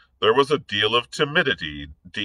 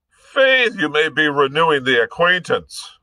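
Irish gentleman; 8 semitones higher, 5 dB louder than usual. Acted. Blood's mocking retort.

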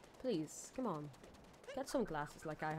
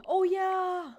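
high, loud delighted "Oh, yeah!"; excited gasp; 11 semitones higher, 9 dB louder than usual. Real, not acted. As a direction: burst out thrilled, voice jumping up. Sudden excited realization.

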